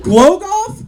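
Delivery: can't believe what she just said; incredulous. Acted. Incredulous echo of her answer.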